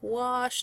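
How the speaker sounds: "Wash" drawn out without an R. Shown as a demonstration. Teacher correcting mother's word, slow "Wash" with no R. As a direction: say "Wash" slowly, open vowel straight into "sh". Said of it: "does not have an R in it".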